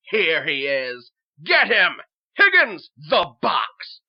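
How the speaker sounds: loud, urgent yelled commands; fierce, full-voice cries; mob shouting. Acted. Mob seizes the king.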